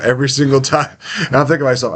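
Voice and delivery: normal talking voice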